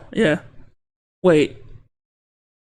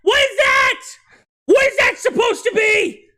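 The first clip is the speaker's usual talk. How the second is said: shrieking disbelief. Real, not acted. Streamer shrieks at a hideous thing on screen, horrified. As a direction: recoil in shouted horror.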